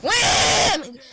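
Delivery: high, loud exaggerated "waah" wail; mock loud crying. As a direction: imitate over-the-top bawling in one loud burst. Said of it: "He wasn't, like, O D crying".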